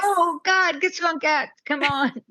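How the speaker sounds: high-pitched mock pleading, urging someone on; high, giddy imitation of excitement; imitating eager impatience. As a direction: squeal it like an excited friend willing them to appear. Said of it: "She's like".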